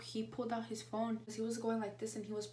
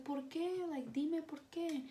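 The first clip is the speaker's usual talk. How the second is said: high, pleading aunt asking why; a lightly voiced aunt, worried and insistent; aunt's high worried ask; 7 semitones higher, slower than usual. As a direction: press her gently for the reason, voice up high.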